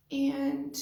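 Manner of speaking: fighting back tears as the feeling wells up; emotional, close to tears. Real, not acted. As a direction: voice rising high, on the verge of tears. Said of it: "oh my gosh, I'm gonna get so emotional".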